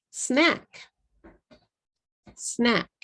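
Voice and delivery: English SNACK with no added vowels; one-syllable English. Shown as a demonstration. Teacher modelling the word, one-syllable English. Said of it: "Snack is one syllable".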